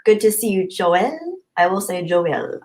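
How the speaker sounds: ordinary talk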